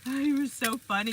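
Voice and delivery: usual speaking voice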